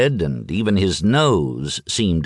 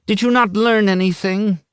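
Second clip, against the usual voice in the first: scolding wife; 11 semitones higher than usual. Acted.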